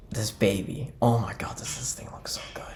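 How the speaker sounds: awed whisper. Real, not acted. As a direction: breathe it out in awe. Awed over the Lego set.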